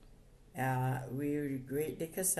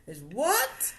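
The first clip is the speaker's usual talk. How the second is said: laughing out loud in disbelief; 9 semitones higher, 6 dB louder than usual. Real, not acted. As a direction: crack up and ask what. Mother bursts out laughing.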